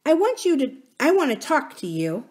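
put-on high, hesitant child's voice; small girl making a shy start; little princess. Little princess addressing the miner boy, high and halting.

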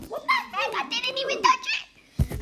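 high squealing disbelief, half laughing; shocked squeal. Real, not acted. Kid gamer falling off the tower, shocked squeal.

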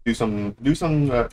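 usual speaking voice

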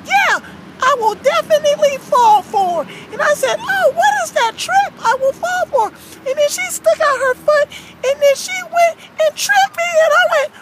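cartoon storytelling voice; aggrieved Frankie. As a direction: tell the grievance in character. Frankie tells of being tripped.

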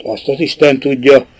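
ordinary delivery

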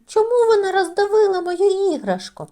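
high, loud upset demand; upset child. A narrator's reading. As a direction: still shouting through tears. Тім кричить на машину. (високим гучним ображеним голосом)

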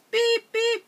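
high, loud beeping like a toy horn; car horn beep. Pretend. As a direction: honk at them like a little truck. Toy truck honks at others.

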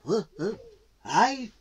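high, halting question; high-pitched, hesitant tortoise; surprised tortoise. Acted. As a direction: stammer in disbelief at being picked.

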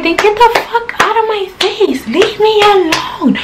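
high mock tell-off to a stalker; retold angry yell. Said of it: "So I'm like".

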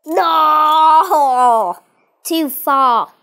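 long, loud wail of dismay; dismayed shout. Real, not acted. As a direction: cry out as the move goes wrong. Crying out at a failed move.

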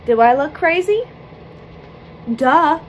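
cheeky mock confidence; playful sass. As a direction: tease yourself playfully. Playful self-teasing.

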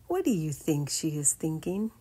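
ordinary delivery

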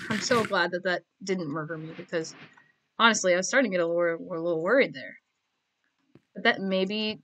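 ordinary talk

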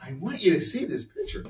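put-on high child's voice; boy showing picture. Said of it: "he shows it to me".

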